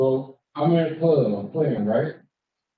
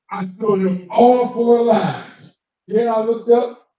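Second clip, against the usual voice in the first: ordinary talk, nothing marked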